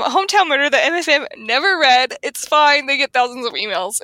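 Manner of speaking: ordinary talk, nothing marked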